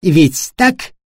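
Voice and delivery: pointed challenging question. A narrator's reading. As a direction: press him for a yes. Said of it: "с вызовом посмотрел на Хорста".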